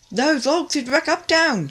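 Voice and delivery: high, slow alarmed cry; alarmed tug crying out as logs rush away; cried out. A narrator's reading. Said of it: "cried Ten Cents".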